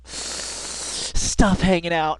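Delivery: slow relish. Real, not acted.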